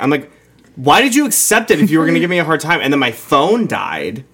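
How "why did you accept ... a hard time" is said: higher, fast indignant comeback; quick, pitched-up mock challenge; retold indignation. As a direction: fire back at the driver, recounted in a rush. Retelling argument with a Lyft driver.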